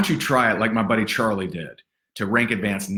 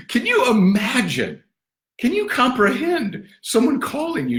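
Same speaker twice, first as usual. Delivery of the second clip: much higher, amused disbelief; incredulous; 14 semitones higher than usual. Real, not acted. Coach asking his audience in amused disbelief. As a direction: ask it as if it's unthinkable.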